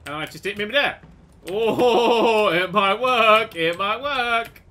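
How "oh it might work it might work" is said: drawn-out, raised hopeful cry; rising excitement. Real, not acted. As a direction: stretch it out with mounting hope. Hopes the plan works.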